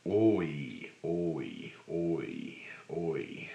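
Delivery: oi demo fading to a whisper. Shown as a demonstration. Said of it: "Pull cheeks and lips outward".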